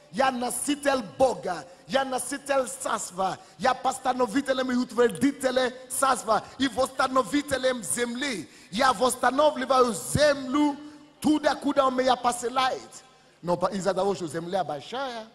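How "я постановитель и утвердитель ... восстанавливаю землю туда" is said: usual speaking voice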